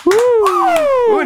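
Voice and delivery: high, loud teasing whoop; cheering. Real, not acted. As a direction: whoop it up for a friend. Cheers at friend's announcement.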